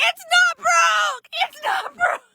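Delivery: high, slow, urgent call; high, drawn-out alarmed call; alarmed yell. Retold or imitated. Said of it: "we're like".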